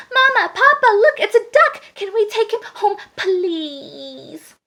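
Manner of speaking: put-on high, loud child calling out, then wheedling; excited little girl. A narrator's reading. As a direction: beg your parents, drawing out please. Little girl begs her parents.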